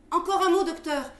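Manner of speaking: loud, high call after someone leaving. Acted. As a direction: call him back urgently, loud and high. Calling after the leaving doctor.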